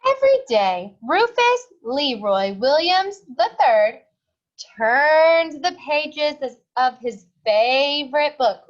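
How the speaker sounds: put-on loud, clear read-aloud to children; storybook reading. A narrator's reading. Teacher reading a picture book to her class, loud and clear.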